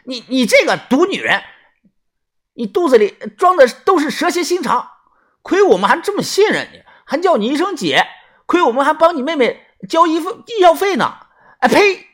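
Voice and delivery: 拔高嗓门愤怒大骂，带哭腔; 气急败坏地骂一个背叛他们的女人; 破口大骂. Acted. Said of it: "豆芽仔破口大骂呀". (尖声破口大骂)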